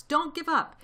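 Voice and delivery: high, loud, mildly winded plea; panted urging. A narrator's reading. Mister Fox heartening his family, panted. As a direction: urge them on, a touch out of breath. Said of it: "panted Mister Fox".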